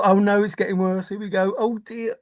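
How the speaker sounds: ordinary talk, nothing marked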